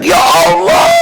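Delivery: mock outcry. Mock outraged cry in anecdote.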